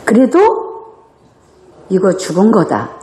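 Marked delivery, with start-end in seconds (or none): none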